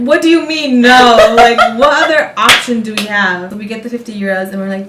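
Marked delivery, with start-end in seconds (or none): 0.1-3.5 loud incredulous protest. Retold or imitated. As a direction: argue that there's no other option. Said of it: "I was like".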